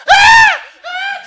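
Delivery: very high, loud screams; shrieking as the swings stop; girls screaming. Retold or imitated. Girls on the swings shrieking at the fight, high screams. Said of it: "the girls are going".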